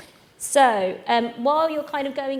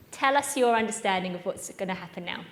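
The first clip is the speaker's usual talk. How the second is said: ordinary talk, nothing marked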